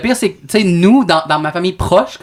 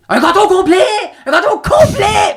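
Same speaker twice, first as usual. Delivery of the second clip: mother's frantic yell; 14 semitones higher, slower than usual. Retold or imitated. Panicked mother yelling at her son on the phone. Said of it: "c'est ma mère qui m'appelle".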